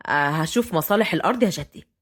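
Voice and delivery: low-pitched male figure, even; young man's voice. A narrator's reading. Fahd answers his grandfather, low and even.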